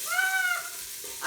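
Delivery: sudden high sharp shriek as oil spits; real jolt of surprise, a piercing cry; startled shriek. Real, not acted. Hot oil spits onions.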